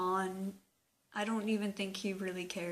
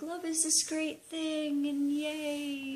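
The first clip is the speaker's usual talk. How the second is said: sarcastic, pitched higher; mock cheerful; 7 semitones higher, slower than usual. Real, not acted. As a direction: parody the rosy view of love. Mocking rosy view of love.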